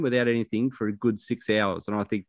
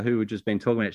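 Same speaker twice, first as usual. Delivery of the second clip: ordinary talk, nothing marked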